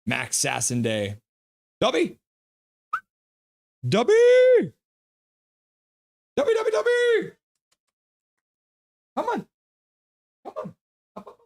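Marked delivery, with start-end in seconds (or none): 1.8-2.2 short, high-pitched call; bright little summons in a raised pitch; calling the dog. Real, not acted. 3.9-7.3 very high, loud, singsong call; piercingly high pet call; calling the dog. Real, not acted. 9.2-11.4 very high, short pet call; high-pitched encouragement to a pet; coaxing the dog. Real, not acted.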